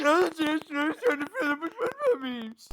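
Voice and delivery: crying-laughing repeat; laughing mimicry. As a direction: repeat it through helpless laughter. Mocking repetition while laughing.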